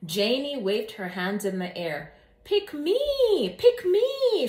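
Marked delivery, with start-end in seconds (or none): 2.4-4.5 much higher, louder eager cry. A narrator's reading. Janie begs the teacher to pick her, crying out eagerly. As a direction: call out to be chosen. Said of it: "she cried".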